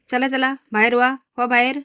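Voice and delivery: mildly acted angry order. A narrator's reading. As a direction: shoo them out of the house. Daughter orders the visitors out.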